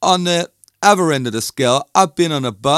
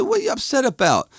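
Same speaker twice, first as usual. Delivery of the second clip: high-pitched, quick retold question; quoting himself, voice jumping up; puzzled question; 12 semitones higher, faster than usual. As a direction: ask in a high voice, puzzled by someone upset. Retelling his question to upset woman.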